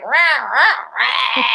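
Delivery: imitated squawking "rah"; mock awful voice. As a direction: squawk out a caricature of a terrible voice. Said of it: "heard his voice and it was like".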